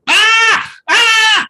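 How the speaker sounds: put-on high, loud baby wail; baby crying out. Coach imitating a newborn's loud wail. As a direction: cry out like a newborn. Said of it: "That's a baby talking".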